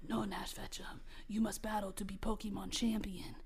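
low, hushed inner voice; mysterious voice. Acted. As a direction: speak softly and low, like an unseen guide. The voice urges the hero on.

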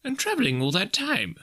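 high-pitched Scrooge voice; musing. A narrator's reading. Scrooge puzzling over the ghost's travels, musing. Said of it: "mused Scrooge".

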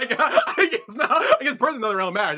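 high, loud talk through laughter; real giggling, words squeezed out; laughing. Real, not acted. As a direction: crack up while conceding the point. Cracking up at the answer.

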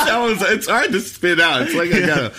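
high, loud, giggly; laughing while talking. Real, not acted. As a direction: laugh through the words, fast. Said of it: "really just trying to get to the end".

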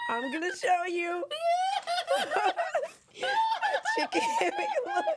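laughing. Real, not acted. Still laughing at video.

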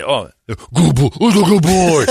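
cop's dog praise. Retold or imitated. Officer praising the dog after the attack, sing-song. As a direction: gush over the dog like a proud handler.

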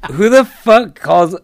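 can't believe anyone would call; incredulous. Real, not acted. Teller ranting to podcast host, loud slow disbelief.

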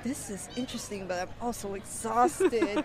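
tired inner thought. Retold or imitated. Inner thought while exhausted.

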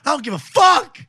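high, loud mock defiance; hammed-up defiant son; mock defiant yell. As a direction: yell it back like a careless teen. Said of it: "And she's like".

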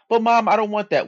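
put-on high, loud whining child voice; whiny kid. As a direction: whine it like a bratty kid. Son whining at mother.

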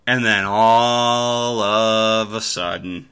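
drawn out, sing-song suspense; slow suspense build. Real, not acted. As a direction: stretch it out to tease the twist. Building suspense before knockout.

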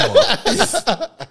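loud high laughter; big laugh. Real, not acted. Storyteller laughing loudly. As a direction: laugh hard.

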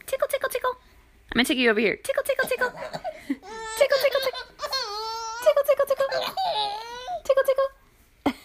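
squeaky high tickle chant with laughs; giggly tickle attack. Real, not acted. Mother tickling giggling baby.

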